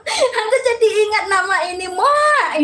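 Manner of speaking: high, laughing through the words; laughing while talking. Real, not acted. Streamer recalling her childhood cries to viewers, laughing. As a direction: laugh your way through the memory.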